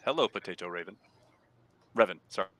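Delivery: ordinary talk, nothing marked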